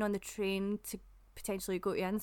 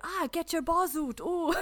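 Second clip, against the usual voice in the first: high, slow, delighted parroting; hammed-up high voice of eager foreigners; mimicking foreigners; 9 semitones higher, 4 dB louder, slower than usual. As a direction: parrot an unfamiliar Scots phrase, pitched up and drawn out. Mimics foreigners repeating Scots phrase.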